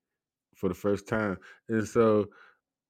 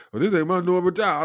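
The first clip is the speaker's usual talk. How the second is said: mock attendant; 11 semitones higher, 7 dB louder than usual. Attendant excuses himself.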